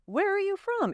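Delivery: bright innocent asking; innocent and well-meaning. Shown as a demonstration. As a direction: ask it with open curiosity. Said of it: "an innocent and well-meaning".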